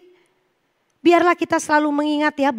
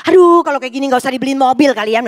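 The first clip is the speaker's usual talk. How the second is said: quick, louder fretting husband voice; mock complaining husband; 6 dB louder, much faster than usual. Wife imitating her husband fretting about the car, mock exasperated. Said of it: "Pak suami saya gitu".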